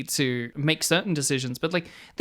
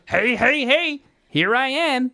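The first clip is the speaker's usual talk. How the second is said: gravelly imitation of a goofy guy; drawn-out, slow, cheerful greeting; gravelly Albert voice; 6 semitones higher, 4 dB louder, slower than usual. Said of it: "he'll go".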